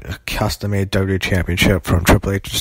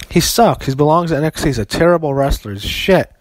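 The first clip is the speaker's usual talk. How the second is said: pitched-up angry insults; heated rant; 6 semitones higher than usual. Real, not acted. Vlogger ranting about a wrestler he hates, heated and high. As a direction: fire off each insult higher and harder.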